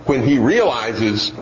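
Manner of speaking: ordinary talk, nothing marked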